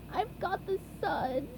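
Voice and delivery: slow, sad and stuffy; sad sick voice. A narrator's reading. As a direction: mope it out, under the weather. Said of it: "said SpongeBob sadly".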